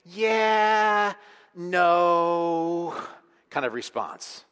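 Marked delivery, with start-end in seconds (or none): 0.0-2.9 a drawn-out Wisconsin yeah, no; polite Midwestern Wisconsin hedge; mock Wisconsin accent. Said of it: "a Wisconsin polite".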